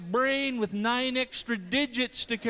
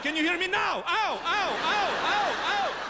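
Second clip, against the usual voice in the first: mock ad voice; 9 semitones higher, slower than usual. Ad slogan then mock pain.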